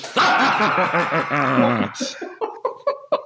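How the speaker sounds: mock sinister shaman cackle; wicked laugh. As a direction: cackle like a triumphant healer. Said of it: "That sounded wicked".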